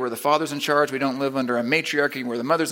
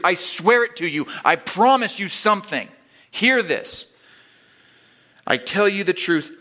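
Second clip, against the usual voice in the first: rising preacher's urgency, higher and louder; preaching; 4 semitones higher, 3 dB louder, slower than usual. Acted. Preacher urging his congregation not to miss this, building intensity. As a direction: press it on the congregation. Preacher voices Jesus' oath.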